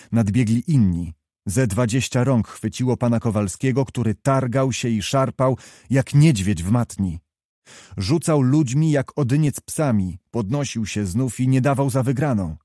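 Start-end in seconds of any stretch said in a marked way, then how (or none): none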